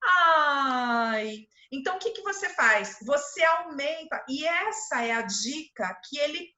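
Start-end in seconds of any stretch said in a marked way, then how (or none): 0.0-1.5 loud, drawn-out cooing "ai"; hablarle a un bebé. Shown as a demonstration. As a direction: stretch it out as if cooing at a baby. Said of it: "você muda o seu tom de voz".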